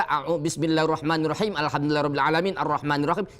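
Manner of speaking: hurried verses without breathing; rushed wrong reading. Shown as a demonstration. Teacher mocking the careless rushed reading, fast. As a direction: race through without a breath. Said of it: "Jangan sekali kita baca".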